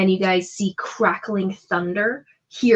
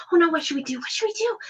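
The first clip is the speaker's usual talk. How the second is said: pixie voices; 9 semitones higher, faster than usual. Acted. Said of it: "They're like".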